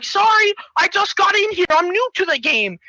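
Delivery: high, hurried-sounding newbie voice; high-pitched apology. Acted. New player apologising to jumper's owner, high-pitched. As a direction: plead innocence up high.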